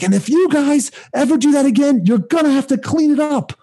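high, strained mock yell; mock screaming official. Assistant threatening the hall about cleaning, high mock yell. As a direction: bellow the threat like an outraged official. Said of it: "He's like".

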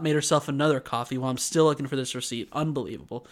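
usual speaking voice

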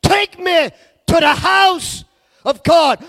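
high, loud, stretched-out preaching cry; preaching at full force. Acted. Preacher crying out to the church to dwell with God, soaring whoop. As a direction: whoop it out to the congregation, slow and soaring.

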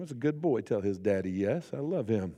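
low, soft proud murmur; a dad pleased with his son during the game. Retold or imitated. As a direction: mutter fondly to yourself.

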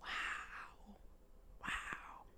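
breathy whispered awe; whispered wow. Retold or imitated. Viewer staring at talking orcs, hushed whisper. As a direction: whisper it in mock wonder. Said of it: "I'm just like".

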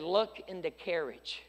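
1 quiet dying reply. Acted. Dying man's quiet answer.

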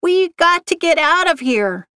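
high, urgent yell, held back in volume; narrator's contained yell, pitched well up. A narrator's reading. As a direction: cry out urgently to escape. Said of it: "Kim yelled".

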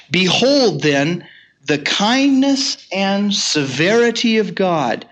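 very slow, drawn-out proclamation; solemn scripture reading. Acted. Preacher reading Paul's warning to the church, slow and solemn. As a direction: lay out each word like a verdict. Reads Romans aloud from the pulpit.

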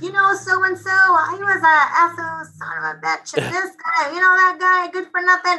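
high-pitched impression of a griping uncle; high mock rant about so-and-so; imitating ranting uncle. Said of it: "usually dinner talks with my uncle".

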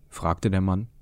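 ordinary delivery

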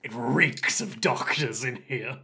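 growing suspicion, slow and heavy; doctor-hater sniffing out doctors. Pretend. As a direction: play a hammy doctor-hunter. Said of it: "you're like".

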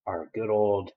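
ordinary delivery, nothing marked

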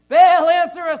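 shouted plea. Acted. Prophets of Baal shouting for their god to answer. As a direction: cry out as if begging a silent god. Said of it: "they shouted".

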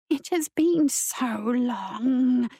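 very slow, drawn-out female spirit; feminine spirit voice. A narrator's reading. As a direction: stretch it out like an eerie woman. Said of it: "Feminine, that voice".

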